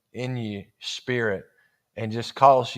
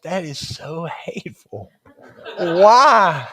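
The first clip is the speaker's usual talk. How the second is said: mock outrage; 3 semitones higher, 6 dB louder, slower than usual. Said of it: "And I was like".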